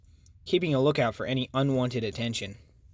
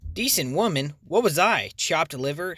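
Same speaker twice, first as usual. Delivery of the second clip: higher, louder indignant snipe; sniping; 3 semitones higher, 4 dB louder than usual. Acted. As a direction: bite back, offended. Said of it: "sniped Carlene".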